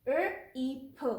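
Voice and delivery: "R E P" as slow separate sounds, er, ee, puh; sounding out letters. Shown as a demonstration. Teacher sounding out the word, letter by letter. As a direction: sound out "R E P" slowly, one letter at a time. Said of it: "The word is".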